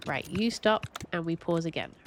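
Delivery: ordinary delivery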